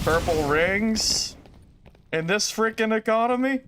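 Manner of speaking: slow, high, drawn-out outraged question; mock outrage. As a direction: ham up the disbelief. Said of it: "meme line, hammed second take".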